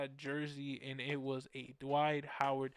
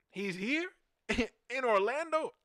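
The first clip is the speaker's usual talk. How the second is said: disbelieving question, rising high and louder; astonished; 7 semitones higher, 4 dB louder, slower than usual. Retold or imitated. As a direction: act out stunned excitement, stretching the last word. Retold astonishment at the news.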